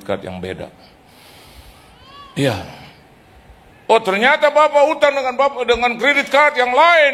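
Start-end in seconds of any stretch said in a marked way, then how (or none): 3.9-7.1 high, loud, pushy questioning; loud and high interrogating tone; mock collector. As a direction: imitate a bossy collector catching him out. Debt collector confronting the debtor.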